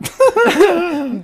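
loud, high burst of laughter; sudden high, loud laugh. Real, not acted. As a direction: burst out laughing, pitched well up. Laughing mid-story about grandma.

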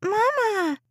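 put-on high, whiny little girl calling; shrill whiny child. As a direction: whine it like a bored child. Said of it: "a shrill, whiny voice interrupted".

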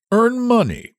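high, startled echo of earn money; surprised repeat. Acted. Squire repeating the boy's words in surprise. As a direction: echo his words in disbelief. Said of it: "repeated the squire".

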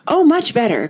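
high-pitched cry that drops at the end; excited cry. A narrator's reading. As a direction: exclaim it brightly up high. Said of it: "cried the Queen".

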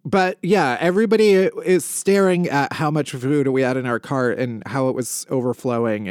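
ordinary talk, nothing marked

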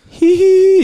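loud, very high-pitched "hee hee"; imitated giggle. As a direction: squeal out dad's giggle, shrill and long. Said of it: "He's, like, giggling".

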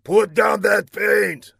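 loud, high, slow angry order; villain's snarl. A narrator's reading. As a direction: snarl a drawn-out command at an underling. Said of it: "snarled Laszlo".

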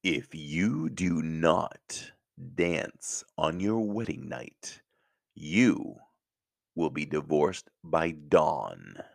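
slow, menacing villain threat; evil Disney villain voice. Acted. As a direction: deliver it like a cartoon baddie. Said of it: "Evil Disney voice".

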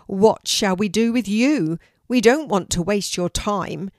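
a loud, mocking sneer from the big ginger cat; sneering tomcat. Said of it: "He sneers".

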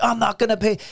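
high-pitched retold protest; mock indignant refusal. Imitating friends refusing to pay the hookers, mock indignation.

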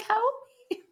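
tiny, very high cat voice; pleading kitty. Pretend. Cat at the blind begging to be saved, tiny high plea. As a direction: squeak a soft mock plea for rescue.

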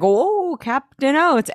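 high, drawn-out playful voice; high mock-heroic coo; playful swoop. Acts out toy horse swooping in.

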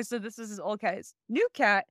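ordinary talk

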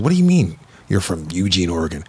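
ordinary talk

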